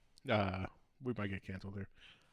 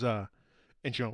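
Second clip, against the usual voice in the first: ordinary talk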